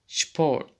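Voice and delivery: sport said shport with sh; German sp sound. Shown as a demonstration. Teacher showing German sp at the start of a word. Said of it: "şıp diye okunmaktadır".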